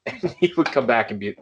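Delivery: normal talking voice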